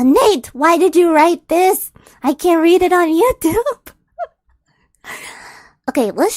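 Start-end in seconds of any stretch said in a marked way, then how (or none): 0.0-3.9 loud, half-laughing protest; reading aloud, shocked at the script; exasperated outcry. Real, not acted. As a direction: call out the writer in disbelief. Exasperated at writer.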